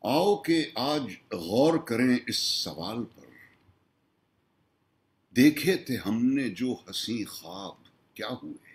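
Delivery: measured Urdu nazm recital, lines drawn out; stately mushaira-style poetry reading; poem recitation. Acted. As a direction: recite it as verse, pausing after each line.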